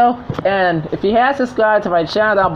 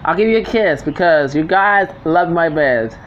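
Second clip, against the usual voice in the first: usual speaking voice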